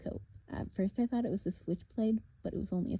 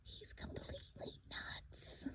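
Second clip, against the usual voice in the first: breathy unvoiced whisper; 18 dB quieter, slower than usual. Girl whispering to Percy about the madman, whisper. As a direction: whisper it to your friend. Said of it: "Bianca whispered to me".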